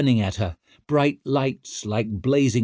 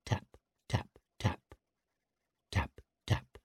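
whispered tapping; 4 semitones lower, 11 dB quieter than usual. A narrator's reading. Tattoo needle sound.

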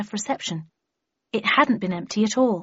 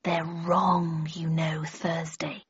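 low, soft, gloating whisper; slow menace breathed into an ear, savouring the last words; 3 semitones lower, 6 dB quieter, much slower than usual. A narrator's reading. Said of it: "whispered in my ear".

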